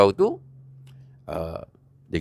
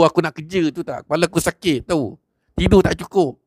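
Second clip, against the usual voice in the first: furious yelling; 6 semitones higher than usual. Acted. A young man rants at Haji Hamid, high and furious. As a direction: rant at him like he ruined your sleep.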